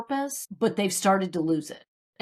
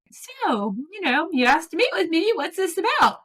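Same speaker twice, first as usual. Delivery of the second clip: high, forced-casual question; mock-brave nervous ask; nervous bravado; 9 semitones higher than usual. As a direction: hide your nerves behind a breezy tone. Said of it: "I sit down and I'm like".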